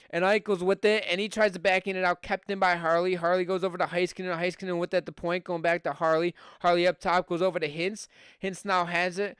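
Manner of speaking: brisk hockey play-by-play call; live play-by-play. Acted. Streamer calling the hockey game for his viewers, live play-by-play.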